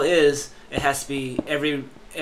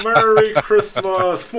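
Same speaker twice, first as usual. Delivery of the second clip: slow, booming, higher Santa greeting; jolly Santa voice; 8 semitones higher, 11 dB louder, much slower than usual. Acted. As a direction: stretch it out like Santa Claus. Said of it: "Santa Claus is here".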